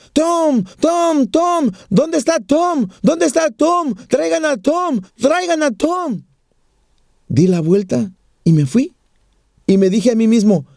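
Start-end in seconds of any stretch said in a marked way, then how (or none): 0.1-6.2 high-pitched calling out, level steady; crying out for Tom through the night; retold desperate cry. Nurse retelling dying man's cries for Tom, high pleading call. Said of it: "gritaba desde lo más profundo".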